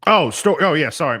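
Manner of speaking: high, loud burst of realization; caught off guard. Real, not acted. Caught off guard, reminded of story.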